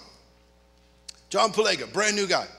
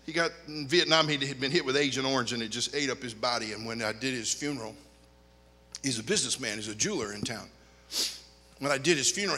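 usual speaking voice

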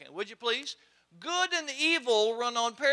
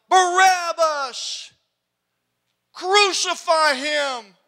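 crowd yell; 5 semitones higher, 9 dB louder, much slower than usual. Acted. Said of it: "hears his name by a crowd".

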